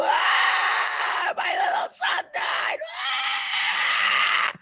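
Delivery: high whispered wail of a grieving parent; mock wailing scream. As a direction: cry out in grief, breathy and high. Retold Egyptians screaming in dark.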